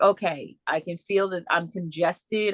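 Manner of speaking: usual speaking voice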